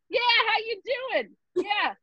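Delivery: high, hammed-up cool talk; patient joking with the intern mid-exam; mock cool patient. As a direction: act breezy and high-pitched. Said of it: "I'm like".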